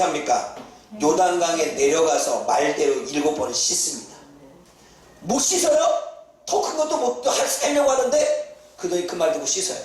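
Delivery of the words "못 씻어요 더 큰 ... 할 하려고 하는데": high, incredulous coaxing; higher, insistent plea from the servant; pleading servant. Retold or imitated. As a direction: needle him into washing.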